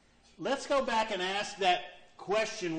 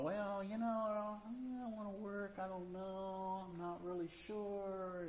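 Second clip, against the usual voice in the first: lazy worker voice; 4 semitones higher, 9 dB quieter than usual. Retold or imitated. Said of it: "Oh, you know".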